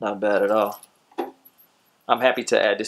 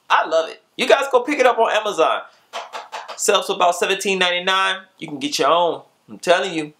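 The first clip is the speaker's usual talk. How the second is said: hyped outro; 6 semitones higher than usual. Acted. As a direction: pump up the outro like a host. Energetic channel outro sign-off.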